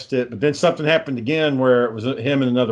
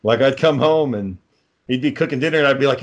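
ordinary talk, nothing marked

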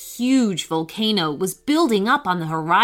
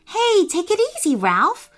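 worried cry; 10 semitones higher than usual. A narrator's reading. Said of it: "cried Lee worriedly".

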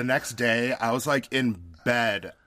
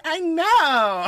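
high squeaky laugh; 7 dB louder than usual. Retold or imitated. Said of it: "As I'm like".

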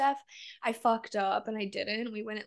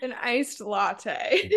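slow, drawn-out teasing emphasis; 4 dB louder, much slower than usual. Real, not acted. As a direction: stretch each word to tease. Teasing repeat of drink name.

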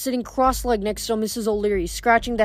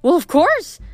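bright exclamation; 8 semitones higher, 5 dB louder, slower than usual. Acted. Mother answers brightly.

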